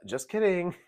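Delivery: high, loud mock "just kidding"; playful take-back. Podcaster imitating a player taking it back, playfully high. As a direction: toss it out as a cheeky retraction. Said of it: "she's just so, um, like".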